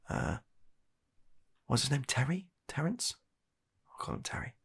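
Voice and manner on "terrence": normal talking voice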